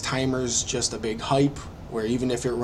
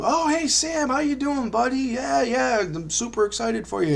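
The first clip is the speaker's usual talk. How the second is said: put-on much higher, upbeat Southern accent; Pat's cheery Southern voice; 12 semitones higher than usual. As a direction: play an overjoyed Southern sponsor. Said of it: "Pat's got this distinct Southern voice".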